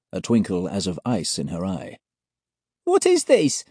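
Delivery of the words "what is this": very high frightened squeak. A narrator's reading. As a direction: squeak like a startled mouse. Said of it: "Alex squeaks".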